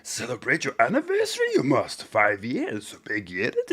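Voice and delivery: lightly voiced wise little alien, words inverted; Yoda. Quoting a viewer's Yoda message to the stream, in a light Yoda voice. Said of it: "Master Yoda says".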